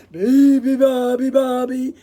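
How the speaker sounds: loud bellowing chant. Acted. Bears bellow the song together. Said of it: "bellowed the bears".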